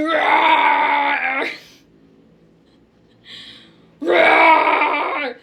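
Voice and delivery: loud playful roar; hammed-up monster roar; shark roar. Roaring as Principal Shark.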